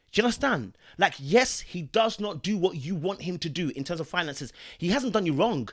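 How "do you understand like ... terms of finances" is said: normal talking voice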